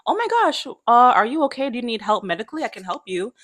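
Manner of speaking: high-pitched start, settling as the offer comes; sweet concerned lady. Retold or imitated. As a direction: imitate a kind stranger fussing over someone. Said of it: "this sweet random lady who's like".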